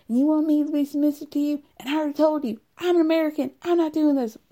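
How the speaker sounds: high, screechy mocking rant at the husband; screeching Angela. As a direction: shriek it like a bratty wife who won't back down.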